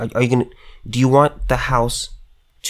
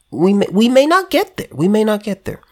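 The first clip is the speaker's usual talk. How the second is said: mock sheriff; 8 semitones higher than usual. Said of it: "the sheriff's department said".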